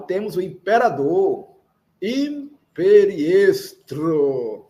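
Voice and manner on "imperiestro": imperiestro slow, with a trilled R in the final TRO; rolled R. Shown as a demonstration. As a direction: roll the R at the end of imperiestro. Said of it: "Olha o R vibrante".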